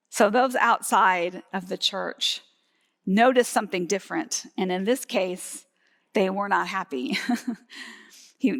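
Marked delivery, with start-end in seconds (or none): none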